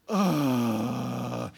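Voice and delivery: mock teen groan of disgust; exasperated teen groan; Napoleon Dynamite groan. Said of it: "Your best Napoleon, uh, Napoleon Dynamite impersonation".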